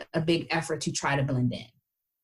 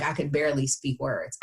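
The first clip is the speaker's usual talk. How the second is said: normal talking voice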